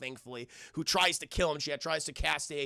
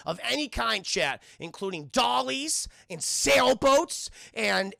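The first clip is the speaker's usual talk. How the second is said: usual speaking voice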